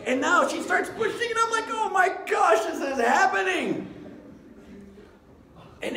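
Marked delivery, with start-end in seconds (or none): 1.7-3.9 high, loud cry of alarm; panicked realization. Retold or imitated. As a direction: act out a shouted panic. Retold panic as birth starts.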